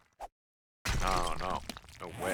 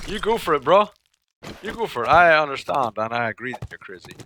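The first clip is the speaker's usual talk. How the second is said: higher, louder sarcastic cheering; higher, louder teasing you go for it; mock encouragement; 6 semitones higher, 9 dB louder than usual. Real, not acted. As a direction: egg him on, teasing. Teasing another player.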